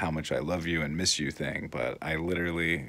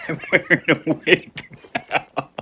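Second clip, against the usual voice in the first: laughing; 10 semitones higher, 7 dB louder than usual. Real, not acted. Laughs at own appearance on camera.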